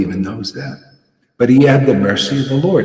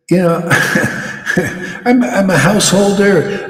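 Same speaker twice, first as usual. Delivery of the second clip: higher-pitched, laughing imitation; 8 semitones higher than usual. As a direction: laugh into the retold excuse. Laughs while voicing excusing devotees.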